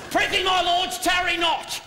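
high, booming Shakespearean rallying cry; hammy stage actor. Acted. Elizabethan rallying speech to team.

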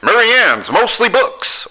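high, slow radio-ad pitch voice; mock local radio announcer; cheesy radio ad. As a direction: sell it like a corny local commercial. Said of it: "It was always about".

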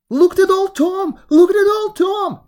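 high, loud alarmed scream; wife screaming in fright at the swelling cat. Acted. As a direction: shriek it at the top of her lungs. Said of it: "screamed his wife".

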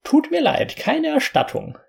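very high, squeaky fairy. Acted. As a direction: chirp a cheeky refusal. Fairy refuses.

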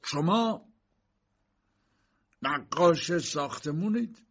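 slow, measured question from authority; authoritative. A narrator's reading. Fat examining magistrate questions the accused K, authoritative. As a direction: question him slowly and with authority. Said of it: "با اقتدار به کی گفت".

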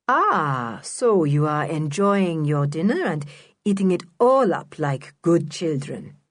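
a lightly voiced, patronising grown-up; condescending Mister Lenoir. Mister Lenoir talking down to the children, patronising. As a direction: talk down to them as if they were small. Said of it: "spoke to them as if they were very small".

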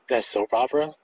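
ordinary talk

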